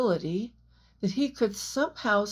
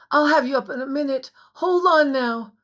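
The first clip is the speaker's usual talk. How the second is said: higher, louder, fast; urgent reassurance; 5 semitones higher, 6 dB louder, faster than usual. A narrator's reading. Jack urgently reassuring Anne below, fast and loud. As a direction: rush the promise out.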